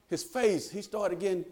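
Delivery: normal talking voice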